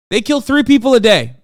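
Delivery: loud, high, furious repeat; angry shout. Real, not acted. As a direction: yell the stat at them. Repeating the stat, now shouting.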